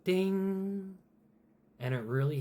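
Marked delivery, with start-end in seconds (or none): 0.0-1.0 high, louder lone ding; single bell ding. Acted. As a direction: ring it out like a bell. Bell sounds as it steps.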